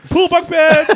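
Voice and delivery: loud, high, laughing exclamation. Real, not acted. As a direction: crack up as you say it. Laughing burst at joke.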